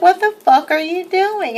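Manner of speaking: a mild replay of the shout; retold yell. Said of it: "And I'm yelling at him".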